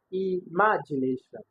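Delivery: "imagination" with MA punched; slow "imagination", leaning hard on the second syllable MA; stress on second syllable. Shown as a demonstration. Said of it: "And on option B".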